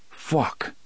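unvoiced whispered fuck; whispered curse. A narrator's reading. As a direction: breathe the curse out under the breath. Cop finds blood on face.

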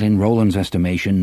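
plain reading voice

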